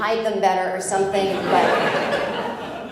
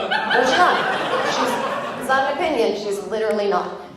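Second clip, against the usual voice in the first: indignant denial; 3 semitones higher than usual. Retold or imitated. Arguing back at the guide.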